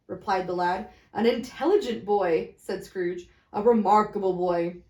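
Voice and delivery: plain reading voice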